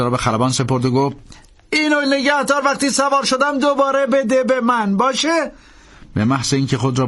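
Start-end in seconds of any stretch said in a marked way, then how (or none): 1.7-5.5 high, firm call to the pilot; priest giving an order. A narrator's reading. Priest ordering the pilot out, high firm call. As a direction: tell the pilot to get out.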